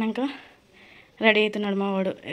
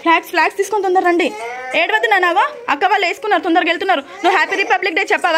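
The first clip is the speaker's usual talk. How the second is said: loud coaxing; 8 semitones higher, 5 dB louder than usual. Real, not acted. Coaxing crying son amid chaos.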